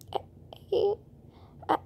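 put-on high, small voice of a shamed child; ashamed little kid. Little Shane admitting to Toshio he wet himself, high small voice. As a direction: voice the little boy, close to tears.

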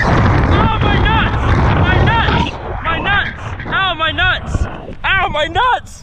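yelling in real pain; crying out, groin just hit. Real, not acted. Harness yanks groin on jump.

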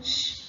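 "ش" as a bare voiceless shh; isolated sheen sound. Shown as a demonstration. Teacher answering her own question to pupils, "ش" as pure shh. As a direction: sound only the consonant "ش", shh with no vowel. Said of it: "يبقى صوت الشين إيه؟".

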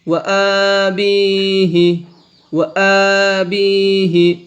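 ওয়া আবিহি with vowels drawn long; slow recited word. Shown as a demonstration. Teacher intones ওয়া আবিহি for pupils. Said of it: "এক আলিফ টানিয়ে পড়তে হবে".

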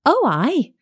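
put-on high little-girl voice; a child's high, ready answer. A narrator's reading. Child answering the wolf in the wood, high girlish voice.

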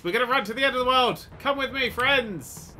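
high, quick mock-heroic call; rally the troop for an adventure; playful rallying call. Rallies his pursuers playfully.

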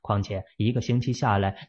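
usual speaking voice

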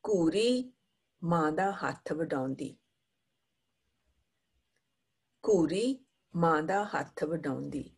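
fast, rhythmic poem reading; poem recital. Acted. Teacher reading a Punjabi poem to the class. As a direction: recite the poem with a bouncing beat.

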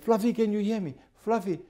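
high, pleading pet call; calling the dog. Retold or imitated. Lady asking if Fluffy can hear her, high calling. Owner calls to unseen dog.

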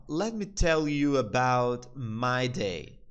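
slow, deliberate English model line; slow teaching repeat. Shown as a demonstration. Teacher to Brazilian students, slow, clear demo. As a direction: spell it out slowly for students. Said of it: "o pai dele então fala assim".

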